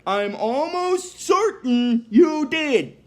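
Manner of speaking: slow, high, smug machine voice; automated voice. Retold or imitated. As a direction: spell it out slowly, coolly certain. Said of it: "the automated voice thing is like".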